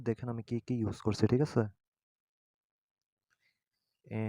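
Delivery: ordinary talk, nothing marked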